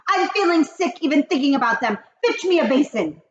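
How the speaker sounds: high, loud, fast witch; sick witch. Acted. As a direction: rush it out retching. Grand High Witch speech.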